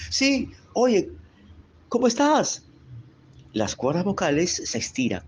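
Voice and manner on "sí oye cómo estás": much higher, bright exclaiming greeting; high exclaimed voice. Shown as a demonstration. Teacher demonstrating a high exclaimed greeting, higher. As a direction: pitch the greeting way up to show high voice.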